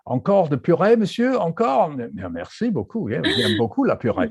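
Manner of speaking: high-pitched, singsong French voice; French person. Acted. Imagined French patient being offered puree, hammy French. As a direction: play an eager French diner in French. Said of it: "I just imagine a French person going".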